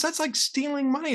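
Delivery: lightly acted dad scolding his child; indignant father.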